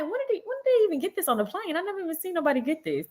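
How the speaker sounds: high, fast amazed questions; incredulous rapid aside; astonished. Retold or imitated. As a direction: rattle it off in disbelief. Astonished at alcohol on plane.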